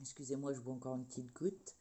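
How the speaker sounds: talking between sips of water; quiet words around a sip; while sipping a drink. Real, not acted. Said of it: "J'ai la gorge sèche aujourd'hui". (en buvant une gorgée)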